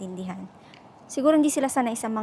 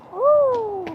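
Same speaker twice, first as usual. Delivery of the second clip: loud, high-pitched whoop; sudden high exclamation; high "ooh"; 12 semitones higher, 6 dB louder than usual. Real, not acted. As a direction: let out a drawn-out, high "ooh". Spontaneous reaction while walking outside.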